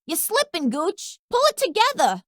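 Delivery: high, sharp self-scolding. Acted. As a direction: snap at yourself to focus. Scolding himself after a miss.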